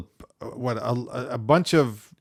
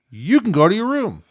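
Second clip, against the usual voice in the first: stern dad; 9 semitones higher, 6 dB louder than usual. Retold or imitated. Dad's comic punishment.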